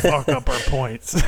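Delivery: very slow, put-on cowboy ad voice; Yellowstone cowboy voice. Podcaster finishing his joke beer slogan, slow cowboy voice. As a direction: draw it out like a strained TV cowboy. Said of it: "trying to sound like I'm from Yellowstone".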